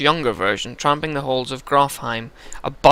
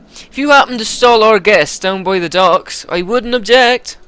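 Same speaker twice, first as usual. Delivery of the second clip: scheming game character; 8 semitones higher, 5 dB louder than usual. Acted. Character hinting to stall the guests, higher put-on voice. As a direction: make the suggestion in a raised put-on voice.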